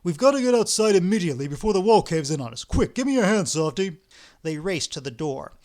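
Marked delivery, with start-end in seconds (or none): 0.0-4.0 loud, fast, urgent call; urgent hurry. Acted. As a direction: rush him out before the wall falls. Ostap urges escape in earthquake.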